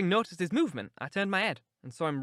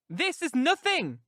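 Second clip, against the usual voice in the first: crying out; 11 semitones higher, 4 dB louder, slower than usual. A narrator's reading. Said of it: "cried she".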